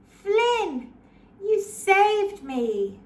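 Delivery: grateful delight. Acted. Rescued princess thanks knight.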